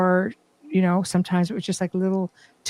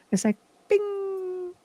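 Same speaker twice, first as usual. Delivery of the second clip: high, drawn-out "bing" like a chime; imitated chime; 12 semitones higher, 6 dB quieter, much slower than usual. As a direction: sing out a soft bell tone "bing". Imitating ghostly chime sound.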